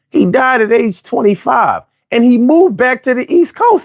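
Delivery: ordinary delivery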